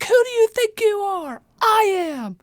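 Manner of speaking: high, loud nerdy shout; nerdy teacher voice. Retold or imitated. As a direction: yell like a nerdy teacher trying to be tough. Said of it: "grade school nerdy teacher voice".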